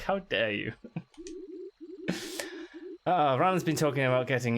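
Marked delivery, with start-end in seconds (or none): 0.8-3.0 breathy giggle. Real, not acted. Laughing at Dalek voice.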